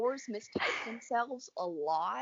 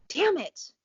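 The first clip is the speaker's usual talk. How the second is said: loud annoyed curse; frustrated outburst; 8 semitones higher, 7 dB louder than usual. Real, not acted. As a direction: snap it out in frustration. Loses her train of thought.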